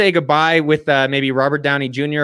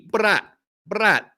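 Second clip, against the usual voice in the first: high-pitched comic catchphrase impersonation; Ali G; 5 semitones higher than usual. Acted. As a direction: toss out the character's catchphrase, pitched up. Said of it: "in the words of Ali G".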